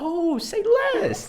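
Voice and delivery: sudden high-pitched slow squeal. Real, not acted. Excited reaction to segment.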